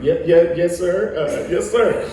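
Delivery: high, loud, stammered agreement; hammed-up obedient yessing; eager deference. Young actor answering his idol's summons, mock eager and deferential.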